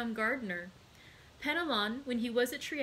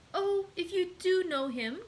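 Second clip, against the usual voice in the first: high-pitched, pleading exclamation; impassioned young woman's appeal; eager cry; 9 semitones higher, 4 dB louder than usual. A narrator's reading. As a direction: cry out in rising hope. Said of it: "cried Julie".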